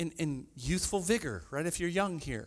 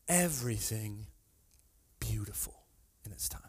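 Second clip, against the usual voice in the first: low, quiet reading; soft scripture reading; 9 semitones lower, 6 dB quieter than usual. Real, not acted. Preacher reads the verse quietly to the congregation.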